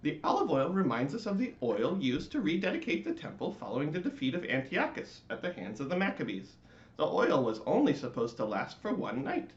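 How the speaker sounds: high, loud earnest voice; latke explaining. Acted. Latke explaining Hanukkah to the lights, high and earnest. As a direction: lecture them proudly. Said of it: "said the latke".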